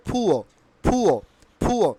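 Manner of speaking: "ফুয়ো" with puffed P, no R; correct pronunciation. Shown as a demonstration. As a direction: say "ফুয়ো" as "phu-o". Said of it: "তাহলে কি উচ্চারণ হবে". ("ফুয়ো", শেষে র ছাড়া)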